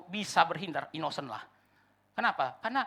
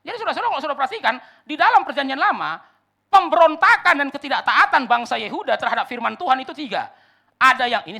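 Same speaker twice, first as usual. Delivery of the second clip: loud, high pulpit proclamation; preacher in full cry; emphatic preaching; 8 semitones higher, 13 dB louder than usual. Real, not acted. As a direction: drive the point home at full volume. Preacher proclaims his main point.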